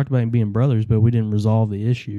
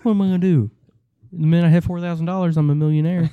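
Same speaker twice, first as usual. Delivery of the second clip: mock bragging; 6 semitones higher than usual. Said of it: "callback to Eric's line".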